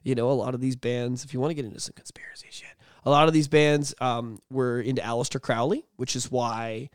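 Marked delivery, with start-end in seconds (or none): 1.2-2.7 fast, hushed whisper; mock conspiracy whisper; conspiratorial whisper. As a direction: lean in and whisper the secret. Hinting at conspiracy theories.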